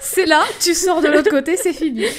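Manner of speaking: plus aigu et plus fort, en riant. Real, not acted. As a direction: blague racontée entre deux rires. Joke about museum size. (en riant)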